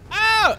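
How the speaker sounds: sudden high yelp of surprise; startled yelp. Real, not acted. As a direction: yelp as if jumped at. Startled by falling enemy.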